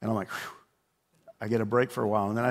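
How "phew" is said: breathy "phew" of relief; relieved exhale. Retold or imitated. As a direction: blow out air, acting relieved. Dad relieved at getting a break.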